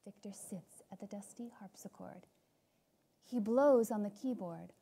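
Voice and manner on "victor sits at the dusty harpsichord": eerie whispered narration; whispering. A narrator's reading. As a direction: hush it, spooky.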